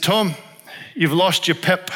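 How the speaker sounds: light Zimbabwean accent, imitating a friend; a hint of Zimbabwean lilt on the quote. Pastor imitating his Zimbabwean friend, light Zimbabwean accent. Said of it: "I'm trying his accent".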